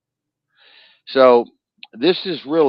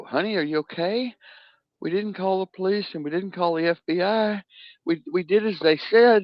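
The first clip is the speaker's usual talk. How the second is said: much higher anxious parent voice; frantic parent; 11 semitones higher than usual. Retold or imitated. Parent calls daughter after paying scammers, high and anxious. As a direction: play the terrified mother on the phone. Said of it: "You go".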